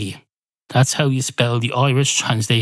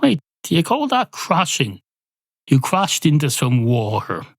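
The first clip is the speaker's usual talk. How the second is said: ordinary talk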